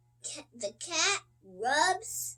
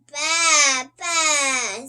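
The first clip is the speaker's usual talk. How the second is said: loud baa; the sheep calling from the barn, loud bleat; 10 dB louder than usual. A narrator's reading. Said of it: "says the sheep".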